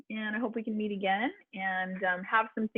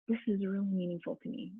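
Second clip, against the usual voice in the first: softer, sincere and drawn in; meaningful, softer; 7 dB quieter than usual. Shown as a demonstration. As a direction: say it like it matters deeply. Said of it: "the inflection of the voice".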